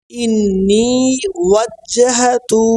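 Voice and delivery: ইন্নি ওয়াজ্জাহাতু slowly, with long held vowels; correct pronunciation. Shown as a demonstration. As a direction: recite ইন্নি ওয়াজ্জাহাতু drawn out for learners to repeat. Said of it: "উচ্চারণগুলো সহি হয়".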